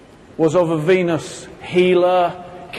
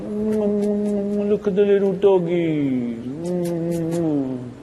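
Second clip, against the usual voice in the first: lightly voiced slow dreamy cooing; mildly imitating someone melting over a puppy; dreamy Venus person; much slower than usual. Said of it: "it's like".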